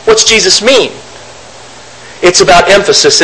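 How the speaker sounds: normal talking voice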